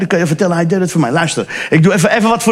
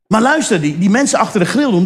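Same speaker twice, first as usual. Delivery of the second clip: ordinary delivery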